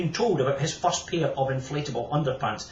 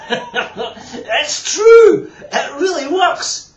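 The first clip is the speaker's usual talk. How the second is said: high, loud laughing delight; 14 semitones higher, 12 dB louder, much slower than usual. Acted. Kenny back from the toilet, laughing in glee. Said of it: "Kenny laughed".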